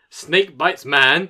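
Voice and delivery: slow, loud deadpan headline, word by word; headline delivery. Real, not acted. Reading a snake headline to co-hosts, drawn-out headline delivery. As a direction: read it out with weighty pauses. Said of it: "The way you delivered that was brilliant".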